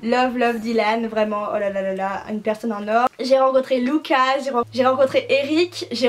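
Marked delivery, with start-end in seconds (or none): none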